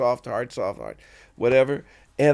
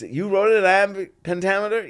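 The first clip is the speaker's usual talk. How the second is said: higher, quick astonished echo; retold amazement, pitched up; incredulous question; 6 semitones higher, faster than usual. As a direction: mimic disbelief at the achievement.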